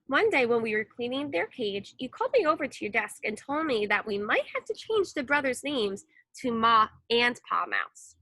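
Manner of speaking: usual speaking voice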